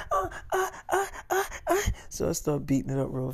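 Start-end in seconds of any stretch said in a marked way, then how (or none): 0.1-1.9 high, much higher cries of pleasure; imitating high pleasure moans, hammed up; mock moaning. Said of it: "It's like".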